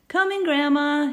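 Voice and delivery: called out high and loud, drawn out; shouting from the other room; calling back. A narrator's reading. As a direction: call to grandma in the next room. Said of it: "he called back".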